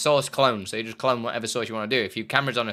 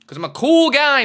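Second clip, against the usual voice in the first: high, loud bragging; cocky brag; 13 semitones higher, 11 dB louder than usual. Pretend. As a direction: boast it jokingly. Boasting loudly.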